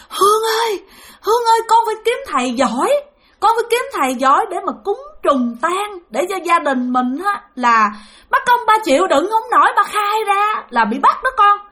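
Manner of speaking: high, plaintive plea to family; urgent pleading. Retold or imitated. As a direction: imitate a desperate spirit begging for help. Spirit begs for a skilled exorcist.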